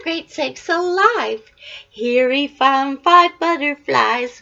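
lively picture-book read-aloud; reading to children. A narrator's reading. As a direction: read it like a bedtime book for a toddler.

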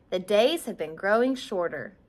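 plain reading voice

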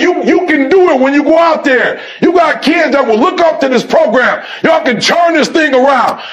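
rising, insistent sermon drive; preaching at full force. Acted. Coach exhorting players to make kids want to come, preaching. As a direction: push each 'you need to' like a preacher.

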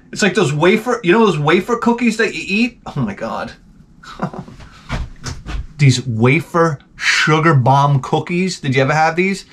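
ordinary talk, nothing marked

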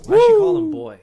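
high loud incredulous question; shocked yelp. Real, not acted. Streamer shocked she calls him boy, loud high yelp. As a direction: blurt it out in disbelief.

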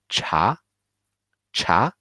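第二声 茶; rising tone. Shown as a demonstration. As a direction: lift cha up like a question. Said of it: "one more time".

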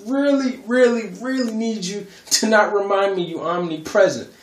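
high, loud exasperated pleading; telling Jesus off in a high, playful whine; exasperated teasing. Retold or imitated. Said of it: "And I'm like".

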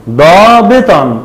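ضابطًا slowly, ending in "-tan"; fatha tanween. Shown as a demonstration. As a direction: say ضابطًا drawn out as "daabitan". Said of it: "بالفتح".

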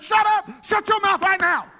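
loud, high, sharp order; angry shout. Retold or imitated. Pastor yelling at panicking teammate on plane, loud angry order. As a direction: yell at him to shut up. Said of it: "I turned around and said".

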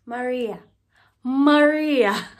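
loud, drawn-out calling of Maria; exasperated call. Pretend. Complaining to viewers that Maria did her dirty, a mock yell.

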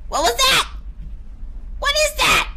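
real fright jumps the pitch up; alarmed high what is that; startled fright. Real, not acted. Startled by game noise.

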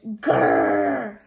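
low growl like an angry dog; dog growl from the hero. Acted. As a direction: growl it deep in the throat. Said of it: "Dogman goes".

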